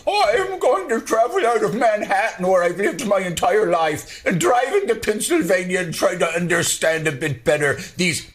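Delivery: high mocking voice of a Manhattan pundit; lightly voiced snobbish New Yorker; mocking impression. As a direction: sneer it up high like a snooty outsider. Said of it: "There are a lot of people".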